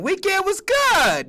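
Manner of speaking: high, slow polite reply; mock colleague. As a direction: answer like a prim coworker. Colleague answers small talk.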